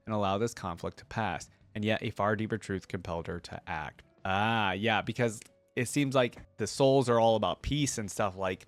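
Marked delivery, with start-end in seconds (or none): none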